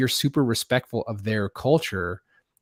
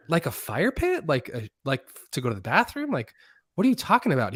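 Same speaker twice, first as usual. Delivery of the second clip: high-pitched, incredulous retelling; baffled questioning; 5 semitones higher than usual. Retold or imitated. Camper asked about digging holes, retelling it baffled. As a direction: act out innocent confusion, voice lifting on each guess.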